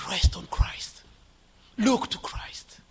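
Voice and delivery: slow, unvoiced whisper; whispered exhortation. Real, not acted. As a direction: urge them gently in a whisper.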